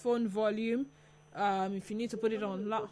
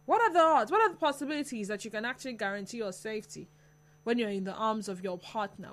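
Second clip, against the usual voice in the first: usual speaking voice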